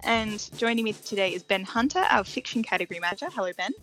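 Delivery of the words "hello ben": ordinary talk, nothing marked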